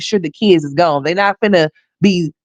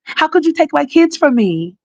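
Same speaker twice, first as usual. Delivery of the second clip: high, whiny mock plea; mimicking the mother's story to the judge, high and whining; mock pleading; 10 semitones higher than usual. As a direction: play her sob story high and pitiful. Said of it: "You finna tell".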